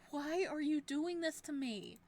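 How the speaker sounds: high, loud frightened plea; desperate plea. Acted. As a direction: beg him in panic. Captive pleads with captor.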